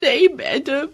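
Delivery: put-on girl crying to her teacher. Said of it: "मेधा रोते हुए बोली".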